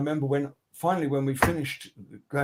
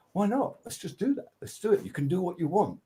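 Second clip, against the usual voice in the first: high, upbeat why-not voice; carefree enthusiasm; 7 semitones higher than usual. Retold or imitated. Band deciding no rules, high upbeat. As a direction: cheer on reckless freedom.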